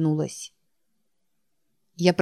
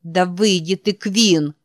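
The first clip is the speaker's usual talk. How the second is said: yelling; 5 dB louder, slower than usual. A narrator's reading. Heroine yells at Quinn.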